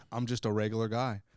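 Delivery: low, understated innocent-guy impression; John Light. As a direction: play it low and blameless. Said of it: "One of my favorite things he says".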